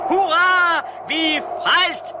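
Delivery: loud, high, slow cheer of relief; rescued group cheering; joyful cheer. Acted. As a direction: shout for joy at rescue.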